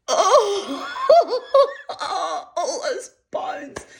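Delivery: high wail dissolving into laughter; mock-horrified, cracking up on the words; laughing mock cry. Reacting to a bony love scene, laughing.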